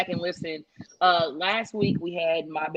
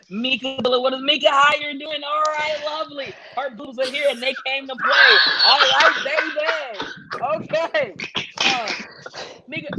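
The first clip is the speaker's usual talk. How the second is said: wrestling promo; 6 semitones higher than usual. Acted. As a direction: announce it like a ringside host. Hosts mock wrestling show.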